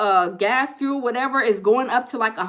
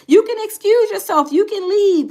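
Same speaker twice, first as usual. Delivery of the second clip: higher and louder, dismissing with sass; sassy dismissal; 8 semitones higher, 5 dB louder than usual. Real, not acted. Telling annoyed viewers to leave her channel, sassy and loud. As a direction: show them the door like a church usher.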